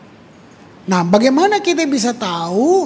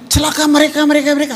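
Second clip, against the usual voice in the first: raised, pointed accusation of others; earlier condemning cry; 5 semitones higher, much faster than usual. Acted. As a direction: point the woe outward, higher voice. (menuding orang lain, nada naik)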